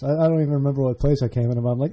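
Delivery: ordinary talk, nothing marked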